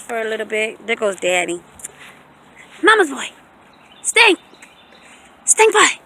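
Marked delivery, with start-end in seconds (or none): 2.8-6.0 high pet voice. Real, not acted. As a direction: coo at the puppy, high and bright. Baby talk to her dog.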